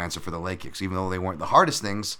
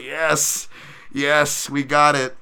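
higher, louder celebratory cheer; 6 semitones higher, 6 dB louder, much slower than usual. Retold or imitated. Coach cheering his fighter's win, loud happy cheer. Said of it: "couldn't help but laugh and cheer".

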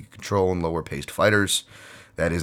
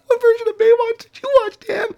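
high, loud laughing self-mockery; laughing at his own bit; 8 dB louder than usual. Real, not acted. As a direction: ask himself through laughter. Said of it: "laughing at himself".

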